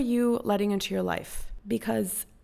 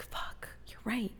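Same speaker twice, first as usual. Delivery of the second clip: whisper; 2 semitones higher, 11 dB quieter, slower than usual. Retold or imitated. Admitting the coach was right.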